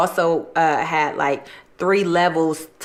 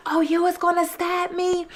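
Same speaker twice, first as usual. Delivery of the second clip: high, repeated mock outrage; mock flip-out; 11 semitones higher than usual. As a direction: mimic someone flipping out, voice pitched way up. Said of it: "he flipped out like".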